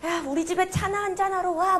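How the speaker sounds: sweet-talking luring tone; luring invite. Retold or imitated. Predator sweetly luring the mouse, coaxing. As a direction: lure the mouse in gently. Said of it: "막 이렇게 꼬시는데".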